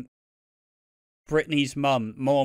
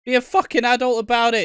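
higher and louder exasperated telling-off; mock angry rant; 7 semitones higher, 6 dB louder than usual. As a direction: scold him to grow up. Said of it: "I'm like".

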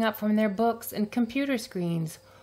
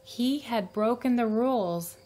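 ordinary delivery, nothing marked

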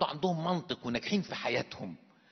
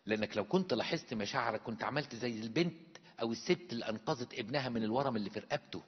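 ordinary delivery

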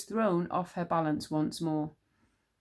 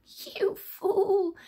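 high, hissed witch voice; King Witch scorning Tsar; sinister whisper; 14 semitones higher, 4 dB louder than usual. A narrator's reading. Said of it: "he whispered".

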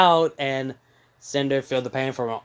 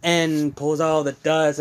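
usual speaking voice

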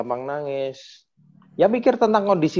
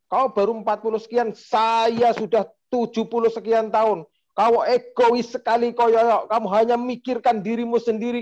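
angry lecture; 7 semitones higher than usual. Retold or imitated. Seventy-year-old figure berating Yoyo, higher and indignant. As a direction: lecture him like a proud elder. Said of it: "kayak gitu".

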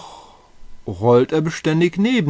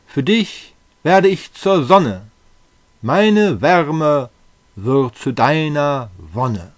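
raised, emphatic, rapturous wooing; ardent love declaration; 2 semitones higher, 4 dB louder than usual. Acted. Suitor vows to be her sun, ardent declaration. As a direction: proclaim your devotion with grand fervour.

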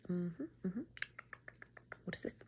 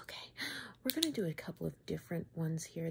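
ordinary talk

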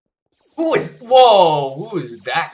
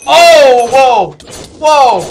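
startled shout; 7 semitones higher, 13 dB louder, much slower than usual. Real, not acted. Overwhelmed by enemies.

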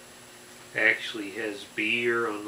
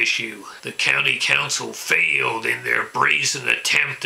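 angry ranting caller; 7 dB louder than usual. Acted. Ranting local political caller impression.

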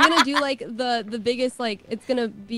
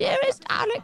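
high-pitched, badly faked British accent; playful, exaggerated British try. Shown as a demonstration. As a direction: attempt a posh-letter British greeting, pitched way up. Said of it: "if I could do a British one".

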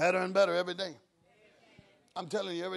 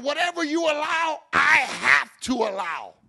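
louder, slowing down on the second half; God's firm answer; 5 semitones higher, 9 dB louder than usual. Retold or imitated. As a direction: lay it down like a final verdict.

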